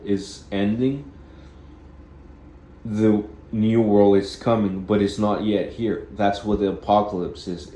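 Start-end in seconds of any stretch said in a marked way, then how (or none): none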